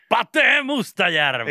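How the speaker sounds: high, loud rock intro yell; intro shout. Retold or imitated. Cohost imagines [name] opening every Popeda song with a high loud yell. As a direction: belt out the name like a song opener. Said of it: "huutaa sitä".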